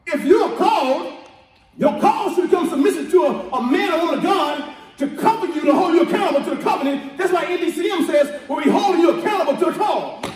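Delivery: shouted, rousing sermon cadence; preaching at full force. Real, not acted. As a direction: preach it hard to the congregation.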